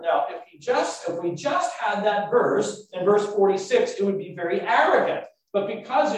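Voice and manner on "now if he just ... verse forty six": ordinary talk, nothing marked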